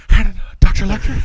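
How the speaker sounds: quick mock Jodie Foster line; Clarice impression. Jodie Foster voiced in retelling.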